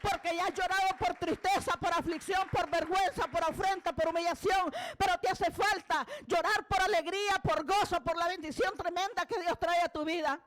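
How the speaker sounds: fervent preaching. Real, not acted. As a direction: drive through the list like a revival preacher.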